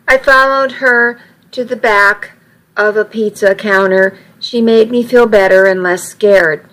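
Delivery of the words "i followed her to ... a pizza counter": plain reading voice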